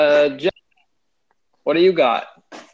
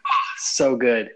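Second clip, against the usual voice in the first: ordinary delivery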